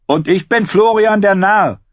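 high, loud playful cry; calling out. A narrator's reading. Robb answering John in their sword game, calling out. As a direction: shout back your hero name. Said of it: "Robb antwortete".

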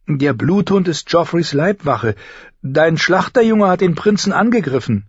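put-on high, defensive girl's voice; arguing older sister. Older sister defends the bodyguard against younger, argumentative.

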